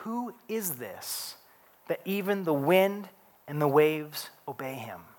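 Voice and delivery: hushed, awestruck question; a frightened, hushed murmur; awed hush. Retold or imitated. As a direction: ask it softly in wonder. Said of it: "They were terrified and asked each other".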